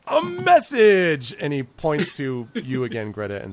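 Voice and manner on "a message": high, loud, drawn-out announcement; emphatic goblin herald; goblin envoy, insistent. Acted. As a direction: proclaim it grandly in a high goblin voice.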